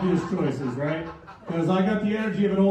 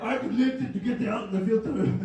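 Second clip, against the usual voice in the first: lightly higher Austrian Arnold voice; Arnold accent; 6 semitones higher than usual. Retold or imitated. Arnold turning down the comedy show, light Austrian accent. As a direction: brush off with a mild Austrian lilt. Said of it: "He's like".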